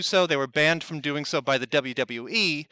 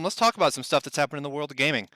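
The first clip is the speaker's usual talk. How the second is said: ordinary talk, nothing marked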